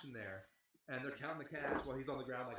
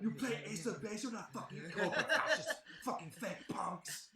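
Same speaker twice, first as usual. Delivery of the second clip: higher, louder mocking tirade that peaks on fascist; mock spitting rage; 9 semitones higher, 5 dB louder, slower than usual. Drunk promoter ranting at the band, higher and louder. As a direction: spit the insults like a ranting drunk. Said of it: "Like, spitting".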